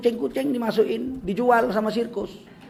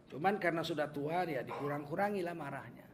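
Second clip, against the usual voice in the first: normal talking voice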